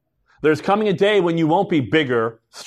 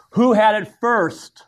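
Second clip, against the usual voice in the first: slow, higher parent's demand; parent intervening; 5 semitones higher, slower than usual. Acted. Parent walks in on two kids fighting over a toy, intervening. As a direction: draw out a parent's question, pitched up.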